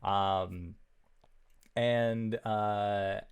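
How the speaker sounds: usual speaking voice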